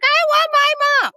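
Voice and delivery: high, loud imitation of a hollering kid; screaming child. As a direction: wail it like a little boy mid-tantrum. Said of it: "he's sitting there screaming and hollering".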